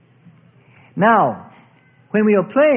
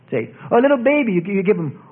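usual speaking voice

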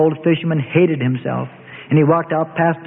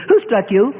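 higher, mocking question; taunting; 6 semitones higher than usual. A narrator's reading. Attacker quizzing the struck prisoner, taunting. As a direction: ask it as a taunt, pitch lifted.

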